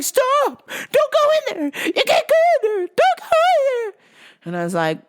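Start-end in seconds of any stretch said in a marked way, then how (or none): 0.0-3.9 very high, loud frantic cries; mock panicked shrieking. Lady stopping him at the restroom door, mock hysterics.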